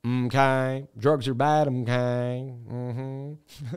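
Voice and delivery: Mr. Mackey drone, lightly done; flat cartoon teacher lecture; South Park teacher. Streamer quoting the South Park teacher, lightly voiced. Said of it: "South Park teacher".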